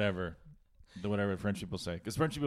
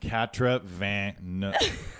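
mocking French number; much slower than usual. Shown as a demonstration. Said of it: "make up a fucking word".

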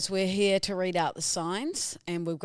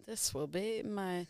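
put-on slow, deliberate Romanian-accented painter; slower than usual. Painter posing a customer and admiring the canvas, Romanian accent. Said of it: "You're Romanian".

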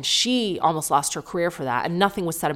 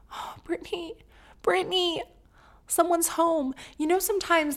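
tender coo; 12 semitones higher than usual. Real, not acted. Cooing over Britney.

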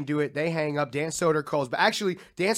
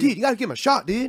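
higher, pleading buddy voice; mock earnest plea, pitched up; Tim Butterly; 6 semitones higher, faster than usual. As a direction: imitate a friend urging someone on. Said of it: "And then Tim Butterly".

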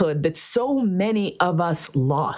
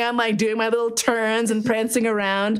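ordinary talk, nothing marked

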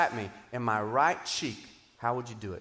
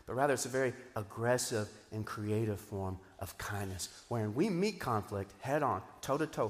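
usual speaking voice

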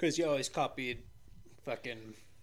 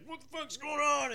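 startled; 5 dB louder than usual. Retold or imitated. Confused soldier quote.

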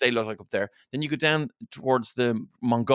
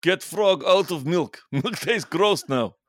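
slow, broken-English grumble, a bit higher and louder; mock caveman Russian; 5 semitones higher, 3 dB louder, slower than usual. Podcaster voicing a villager with a frog in his milk, mock complaint. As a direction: play a simple villager annoyed at the frog. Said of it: "it would have just been like".

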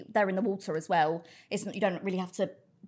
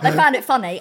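ordinary talk

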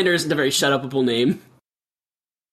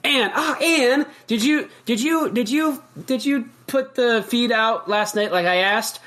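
mom; 6 semitones higher, slower than usual. Acted. Mother voiced in improv scene.

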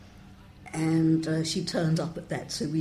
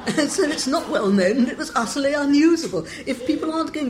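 talking through laughter, louder; laughing; 10 semitones higher, 9 dB louder, faster than usual. Real, not acted. Laughing over his own joke.

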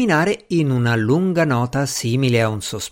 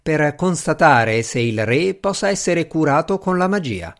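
usual speaking voice

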